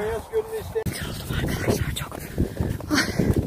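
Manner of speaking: squeezed high strangled voice; strained, barely getting it out. Real, not acted. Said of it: "sesim çıkmıyor".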